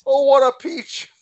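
put-on high-pitched old lady delighted by her shot; mock-thrilled, high character voice; delighted golfer impression. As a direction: coo it in a high, put-on woman's voice. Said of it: "She's like".

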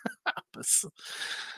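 a high, breathless squeal of a laugh. Retold or imitated. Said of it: "I was like".

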